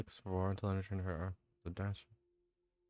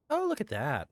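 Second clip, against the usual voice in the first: excited; 7 semitones higher, 6 dB louder than usual. Real, not acted. Pleased at a card.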